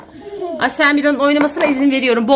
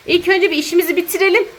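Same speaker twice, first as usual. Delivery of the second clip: ordinary delivery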